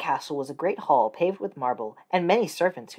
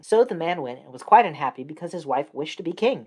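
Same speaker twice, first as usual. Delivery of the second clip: usual speaking voice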